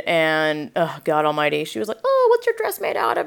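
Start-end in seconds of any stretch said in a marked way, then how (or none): 2.0-3.3 very high, put-on sweet question; mock polite high-pitched interest; gushing neighbour. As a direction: coo it far up in pitch.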